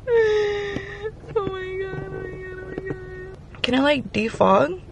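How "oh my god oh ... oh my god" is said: high, cracking voice that fades away; fighting back tears as the voice breaks, dropping softer; voice cracking near tears. Real, not acted. To her viewers over her fogged camera lens, voice cracking near tears. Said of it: "did y'all hear my voice crack".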